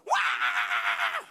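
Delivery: loud, long mock bawling; full-blast baby "waaah"; imitated baby wail. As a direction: erupt like a screaming baby. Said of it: "masih nangis".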